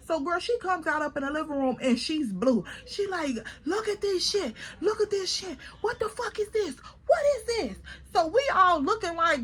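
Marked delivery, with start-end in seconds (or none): 3.7-7.8 retold upset screaming. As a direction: imitate a friend's high, scandalized shrieking without the volume. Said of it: "we hear Dramatic screaming from her bedroom".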